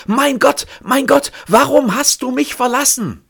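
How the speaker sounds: very high, loud despairing cry; a full-voiced outcry of a broken man; loud scream. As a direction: scream it out where no one hears. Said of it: "schreien, ganz laut, wo ihn keiner hört".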